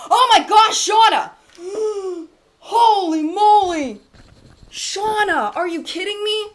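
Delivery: gasping, high and loud disbelief; can't believe what just happened, gasp and cry out; real shocked delight. Real, not acted. Streamer stunned by a viewer's big donation, gasping in real shock.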